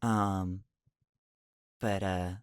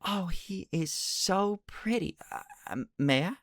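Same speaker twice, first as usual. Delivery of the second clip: high, slow, awed and gentle; a shy forest girl marvelling at a pet; Junifer's voice; 10 semitones higher than usual. Acted. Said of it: "she's like".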